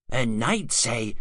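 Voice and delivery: usual speaking voice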